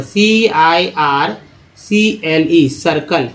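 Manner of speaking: letters spelled slowly; teacher spelling a word letter by letter. Shown as a demonstration. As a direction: slowly spell out सीआईआर, then सीएलई सर्कल. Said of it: "दो example".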